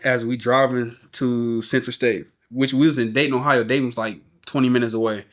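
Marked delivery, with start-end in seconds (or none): none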